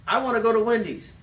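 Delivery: put-on high, loud pleading kid asking dad; kid's voice. Kid pestering his dad with a question, high and loud. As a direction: play the eager child nagging. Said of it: "My children".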